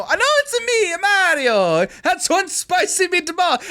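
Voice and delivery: put-on high, slow, over-the-top Italian accent; outrageous Italian accent. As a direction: ham up a cartoon Italian plumber. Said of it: "outrageous fucking Italian accent".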